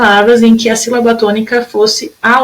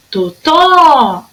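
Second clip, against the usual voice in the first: "Totó" with a long, higher "tó"; stressed last syllable; 4 semitones higher than usual. Shown as a demonstration. Teacher showing the strong syllable, drawn-out "tó" in "Totó". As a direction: call out "Totó", stretching the final "tó". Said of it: "A sílaba mais forte era o tó".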